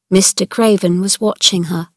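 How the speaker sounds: ordinary delivery, nothing marked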